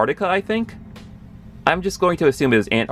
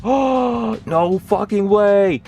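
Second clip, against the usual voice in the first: high, drawn-out fanboy amazement; amazed disbelief; 9 semitones higher, much slower than usual. Retold or imitated. Fan reliving Silver Samurai cameo, amazed disbelief. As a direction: re-enact being blown away, pitched way up.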